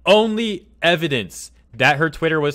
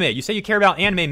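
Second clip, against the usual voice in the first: worked up; 6 semitones higher, 3 dB louder than usual. Real, not acted. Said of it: "And I'm getting worked up".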